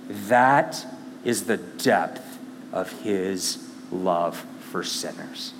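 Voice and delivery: low, soft and slow; hushed awe. Real, not acted. Preacher telling the church of Jesus' love, hushed. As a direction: let the awe sink in. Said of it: "awed conclusion".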